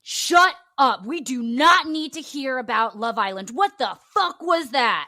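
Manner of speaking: loud, exasperated mock outburst; hammed-up telling-off, hitting the words hard; angry rant. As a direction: act out a host exploding at a contestant. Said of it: "went on his little rant".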